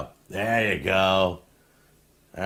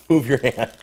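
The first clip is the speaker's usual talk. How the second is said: sharp raised warning; louder, urgent; 5 semitones higher, 8 dB louder than usual. Real, not acted. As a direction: repeat it louder, higher.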